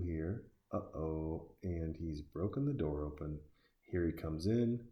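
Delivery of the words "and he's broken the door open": ordinary delivery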